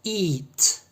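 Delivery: high "Eat" with long ee. Shown as a demonstration. Teacher contrasting it with it, long E sound. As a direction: hold a tense ee in "Eat". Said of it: "has a long E sound".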